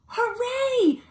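put-on delighted discovery for the kids; high, thrilled hooray; excited gasp. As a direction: gasp then a high cheer. Finding an egg under flap.

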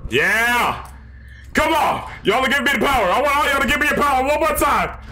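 high, urgent hype shout; excited cheering. Real, not acted. Cheering on for power in game.

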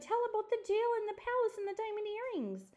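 put-on squirmy girl begging for the story; excited little girl. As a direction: plead excitedly like a fidgety child. Wiggly girl pleading for story.

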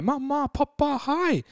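put-on high-pitched little kid calling out; glade småunger. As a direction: put on a high, happy child's voice. Voices happy kids behind him. (lys, glad barnestemme)

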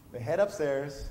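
usual speaking voice

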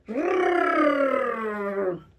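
rolled r. Shown as a demonstration. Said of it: "how do you do it".

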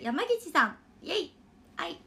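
usual speaking voice

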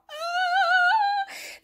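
shrill giggle, very high; high squealing laugh. Real, not acted.

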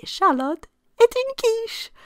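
high, loud teasing Charlotte est une quiche; mock scolding quip. What you'd say to silly Charlotte, mock teasing. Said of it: "you might say".

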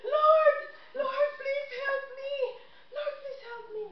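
high, loud cry for help; desperate shout. Retold or imitated. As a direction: yell up to heaven, hanging on for life. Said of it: "So he was shouting".

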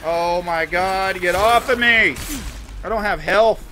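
high, loud panicked yelling; panicking gamer. Real, not acted. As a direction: panic as enemies swarm. Attacked without health.

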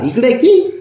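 high, loud rising call; insistent high-pitched beckoning; coaxing call. Real, not acted. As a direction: call a pet over, voice leaping up. Calling the pet over.

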